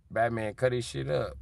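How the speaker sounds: ordinary talk, nothing marked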